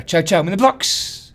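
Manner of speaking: normal talking voice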